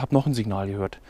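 ordinary talk

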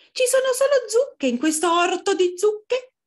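very high, loud little fox; small fox complaining in high pitch. Acted. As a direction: squeak it out like a tiny fox.

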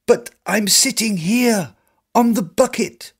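high, pleading call; the beggar calling to the merchant. Acted. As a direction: call up, desperate to be seen. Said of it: "I call out".

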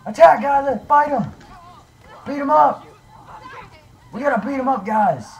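very high, loud commands to fight; battle shout. Acted. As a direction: scream the order to charge. Rallying friends against Jason.

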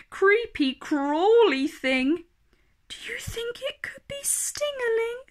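put-on high, fearful child's whimper ending in a whisper; a scared little one calling to mummy; a hammed-up terrified kid under the sheet; frightened child.

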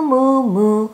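plain reading voice

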